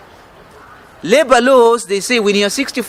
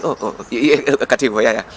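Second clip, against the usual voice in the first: mock flustered; 5 semitones lower, faster than usual. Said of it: "you hear him say".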